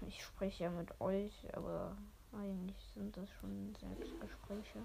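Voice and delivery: hushed whisper, no voice. Real, not acted. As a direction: whisper it like a secret aside. Whispered aside to listeners.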